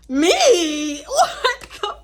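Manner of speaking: high, loud mock disbelief with laughter; incredulous outburst. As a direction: shoot up high, as if wrongly blamed. Acted disbelief at being blamed.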